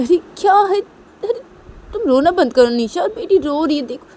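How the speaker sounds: high, loud exasperated burst; exasperated scolding. Acted. Manisha tells Nisha to stop crying, exasperated. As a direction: scold in a shrill-pitched fret.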